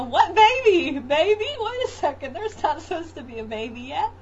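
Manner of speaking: put-on high, loud, startled "what baby?"; a confused new dad caught off guard, voice shooting up; mother imitating her husband's high, stunned disbelief; baffled husband. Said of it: "he's sort of like".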